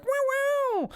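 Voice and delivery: very high electric guitar wail; imitated guitar riff. Game master imitates a guitar as brothers high five, high wail. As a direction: squeal it like guitar strums. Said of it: "like, a guitar".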